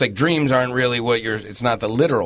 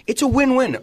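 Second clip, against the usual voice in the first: fake business cheer; 11 semitones higher, 3 dB louder than usual. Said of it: "saying a lot of times in business".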